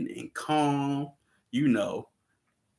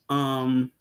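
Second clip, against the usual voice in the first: ordinary talk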